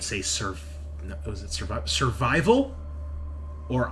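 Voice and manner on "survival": normal talking voice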